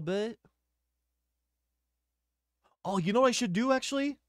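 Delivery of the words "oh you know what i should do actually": high-pitched oh, I've got an idea; light up with a sudden idea; sudden realization. Real, not acted.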